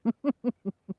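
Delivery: short high laugh; rueful laugh. Real, not acted. Laughing at the long commute.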